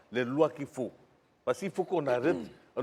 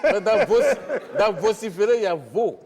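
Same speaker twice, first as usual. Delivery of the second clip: loud, high-pitched jeer with laughter; gleeful raised voice; laughing wordplay; 7 semitones higher, 8 dB louder than usual. Acted. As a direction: crow the pun over the laughter. Jeering pun amid laughter.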